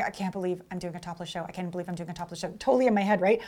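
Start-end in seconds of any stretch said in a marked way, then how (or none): 0.0-1.4 much softer, nervous muttering to herself; anxious inner voice. Retold or imitated. Showgirl on stage in Japan, softly panicking to herself. 1.5-2.5 even softer and fast, panicky muttering; fast anxious mutter. Retold or imitated. Showgirl on stage in Japan, panicking faster under her breath.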